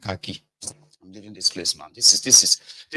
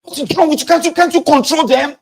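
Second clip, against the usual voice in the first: high, loud exasperated yelling; shouting at the mother of his children in a rage; furious shout at wife; 9 dB louder than usual. Retold or imitated. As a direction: scream at her to control the kids. Yelling at wife over kids.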